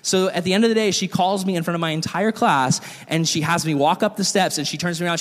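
normal talking voice

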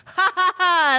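high hammed-up "Ha ha ha!"; laughing at a listener's pun; mock laugh. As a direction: burst out in a big fake laugh. Mock laugh at the review's joke.

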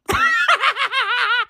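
laughs high in disbelief; a squealing incredulous laugh; real laugh of disbelief at the news; high burst of laughter. Real, not acted.

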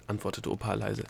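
ordinary delivery, nothing marked

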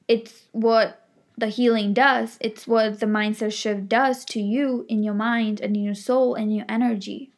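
ordinary talk, nothing marked

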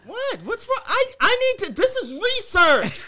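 very high, squealed protest; high-pitched comic outrage, words stretched out; mock indignation. Mock-indignant defense of their research.

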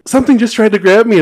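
high, loud panicked yell; retold frightened outcry; re-enacted panic. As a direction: blurt it out in alarm. Retells panicked yell to girlfriend.

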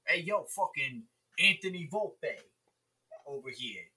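Italian New Yorker. Pretend. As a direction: call out like a New York Mets fan from Brooklyn. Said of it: "He's the Italian New Yorker".

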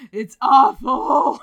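loud, slow laughing wail; anguished laughing cry; laughing groan. Real, not acted. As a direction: moan it through laughter. Reacting to own joke.

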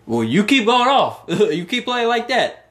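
high, playful coach's approval. Retold or imitated. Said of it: "I'm sure he said".

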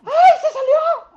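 alarido muy agudo y fuerte; grito imitado. Retold or imitated. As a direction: imita un grito de alarma, alargado y altísimo. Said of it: "y que escucho gritos". (grito agudo imitado)

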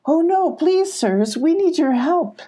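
high, pleading call; calling after. A narrator's reading. Sylvie begging the fleeing goats for help, calling high. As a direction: plead urgently, starting very high. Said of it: "Sylvie called after them".

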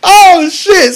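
high, cracking laugh-cry; real laughing. Real, not acted. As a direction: laugh it out, voice breaking. Laughing at own story.

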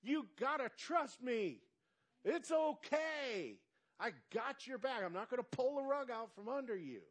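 gentle reassurance. Pretend. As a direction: coax like a friend whispering in the ear. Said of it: "just whispering in our ear".

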